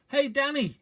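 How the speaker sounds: calling shout. A narrator's reading. Father calls to Danny across the wood, shouting. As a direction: call out to him among the trees. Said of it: "he shouted".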